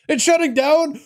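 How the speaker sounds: high disbelieving question; shocked. Real, not acted. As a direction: caught off guard, voice jumps. Reacts to shutdown news.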